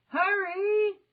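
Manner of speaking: loud high yell. A narrator's reading. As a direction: yell over the wind. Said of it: "Jack yelled".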